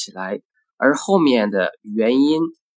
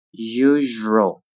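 "Usual" with a held zh; slow careful "Usual"; drawn-out model. Shown as a demonstration. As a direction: stretch "Usual" out for learners. Teacher models word slowly, then normally.

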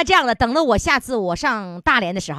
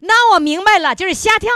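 normal talking voice

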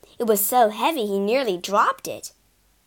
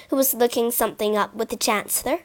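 usual speaking voice

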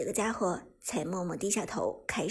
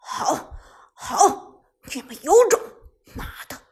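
loud, fuming threat; angry huffing outburst, voice raised; huffy ultimatum; 2 semitones higher, 9 dB louder than usual. Acted. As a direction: start curt, then blow up into a threat. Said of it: "气呼呼地下达了最后通牒，冷哼着说道".

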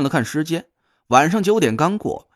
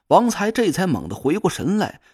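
usual speaking voice

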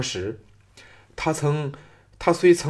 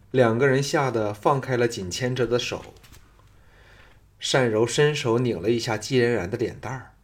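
ordinary delivery, nothing marked

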